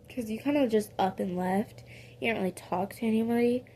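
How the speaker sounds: ordinary delivery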